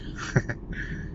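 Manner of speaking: short breathy chuckle; soft laugh. Real, not acted. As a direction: chuckle quietly. Laughs after introducing himself.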